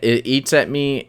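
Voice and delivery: normal talking voice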